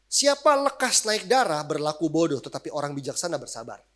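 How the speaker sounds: solemn Bible reading; read verse. Acted. Reading scripture verse aloud.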